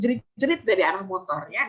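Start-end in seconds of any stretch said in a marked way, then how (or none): none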